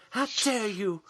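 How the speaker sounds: hammed-up outrage; Greta. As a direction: imitate an indignant public scolding. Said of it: "He's almost like Greta".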